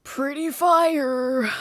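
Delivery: high, loud, slow boast; mock brag. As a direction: stretch it out like a hype brag. Bragging about showering.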